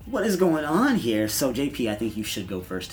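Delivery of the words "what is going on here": surprised. Retold or imitated. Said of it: "I was kinda like".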